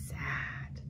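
breathy whisper of the word; whispered sad. Shown as a demonstration. Teacher showing sad sign to toddlers, whispered. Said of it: "our face shows that we're sad".

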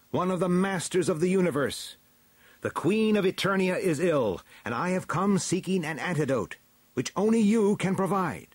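high, earnest hero voice; heroic declaration. A narrator's reading. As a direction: state your mission urgently to the court. Hero pleads his mission to elders.

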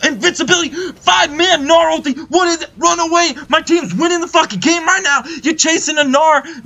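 high, urgent gamer yell; frantic shouting. Real, not acted. Gamer watching his team chase a Gnar, frantic shouting. Panicked call as team fights.

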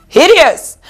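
hideous punched high and loud; emphatic disgusted hideous. Acted. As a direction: spit out hideous. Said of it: "It was hideous".

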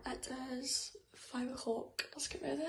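very soft early-morning whisper. Real, not acted. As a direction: whisper so nobody wakes.

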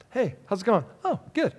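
quiet, muted small talk at the door; hushed greeting exchange. Acted. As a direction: greet them softly and awkwardly. Walking into a home.

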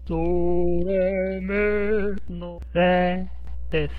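drawn-out ドレミのレで; slow explanation. Shown as a demonstration. Teacher slows down to explain the sound. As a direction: stretch ドレミのレで slowly for the learners.

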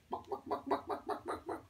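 soft, toneless rapid clucks; chicken clucking. Pretend. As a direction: cluck softly like a hen. Chicken sound for a child.

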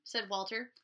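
ordinary delivery, nothing marked

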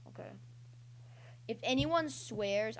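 usual speaking voice

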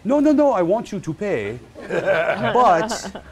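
loud, very high, emphatic cooper; Philippe. Retold or imitated. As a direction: insist loudly with pitch soaring. Voicing cooper's emphatic payment reply.

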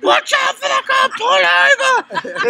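high, loud imitation of a panicking passenger; panicked shout. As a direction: yell at the driver to stop. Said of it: "He's like".

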